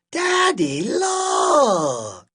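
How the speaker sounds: put-on loud, high child's shout with a long "look"; big excited holler; excited calling out. A narrator's reading. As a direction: call out to Daddy, stretching the last word. Child calls out to father.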